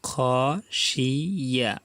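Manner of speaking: খশিয়া stretched very slowly, each sound held; drawn out. Shown as a demonstration. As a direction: drawl খশিয়া out for learners. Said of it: "teacher drawls then repeats". (খশিয়া খুব টেনে ধীরে ধীরে)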